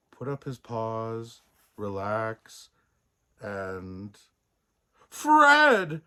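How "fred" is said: loud, high roar; a sudden alarmed roar. A narrator's reading. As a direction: bellow his name across the room. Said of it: "roared the postmaster".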